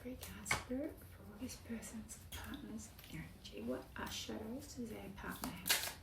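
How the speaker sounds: hushed, prayer-like whisper to Spirit; whispered invocation. Real, not acted. Reader asking Spirit for the partner's cards, in a reverent whisper. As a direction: ask the spirits quietly, almost praying.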